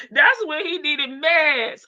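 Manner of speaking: laughing through the words, slow. Real, not acted. Streamer joking to chat, laughing. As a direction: say it while cracking up.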